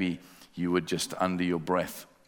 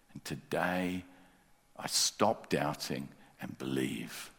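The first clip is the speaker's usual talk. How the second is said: slow hushed prayer; slower than usual. Shown as a demonstration. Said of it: "whisper this yourself".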